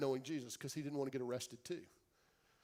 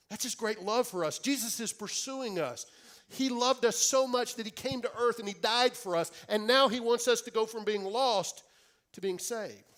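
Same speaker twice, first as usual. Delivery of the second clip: louder, higher, preaching with building fervour; preaching at full force; 7 semitones higher, 9 dB louder than usual. Acted. Preacher urging the congregation, full-force preaching. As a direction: proclaim it to the whole church.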